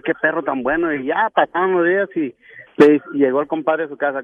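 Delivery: ordinary talk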